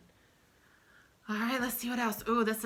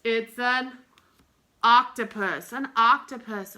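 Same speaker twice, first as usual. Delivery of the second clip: loud, bright announcement of the answer; loud excited answer; excited reveal; 12 dB louder than usual. Acted. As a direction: proclaim it like the big reveal.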